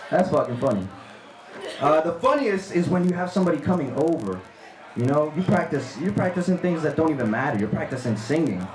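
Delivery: ordinary talk, nothing marked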